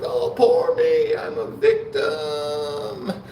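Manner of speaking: very high, slow fake-crying whine, wailing the boo hoo; mocking whiny crybaby. Mocking a new inmate crying about a year, high fake sob. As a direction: ham up a self-pitying new inmate blubbering. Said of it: "They're like".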